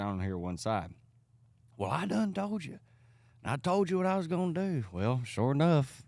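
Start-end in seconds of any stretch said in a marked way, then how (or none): 1.8-4.8 high-pitched pastor fuming into the phone; mock angry caller. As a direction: act out an irate phone rant. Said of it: "this is a two-way conversation".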